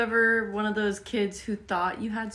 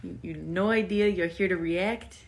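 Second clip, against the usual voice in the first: usual speaking voice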